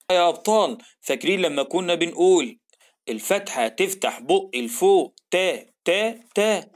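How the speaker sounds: ordinary talk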